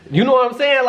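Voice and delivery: laughing while asking. Real, not acted. Laughing at his own story.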